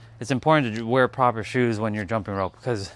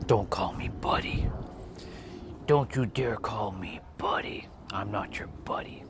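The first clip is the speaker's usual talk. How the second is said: whisper; 5 dB quieter than usual. Retold or imitated. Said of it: "I used to be so fixated".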